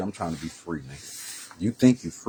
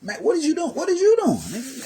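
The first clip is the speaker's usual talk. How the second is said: very high, louder, rising panic; spooked cop, voice climbing higher in panic; mock panicked cop; 5 dB louder than usual. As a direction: squeal it out like a freaked-out cop. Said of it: "That's exactly what I'm thinking".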